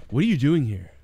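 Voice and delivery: casual, lightly mocking; mock sudden calm. Abrupt calm after mimicked panic.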